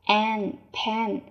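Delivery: ordinary talk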